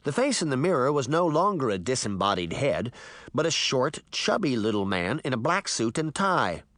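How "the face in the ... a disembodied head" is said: usual speaking voice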